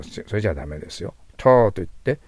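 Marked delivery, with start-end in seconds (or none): 1.4-1.7 loud, held ター with the tongue dropped; English vowel demo. Shown as a demonstration. Teacher coaches tongue position for term, English vowel demo. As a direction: open the throat wide on a long ター, English "ter". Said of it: "ベロを下に落として".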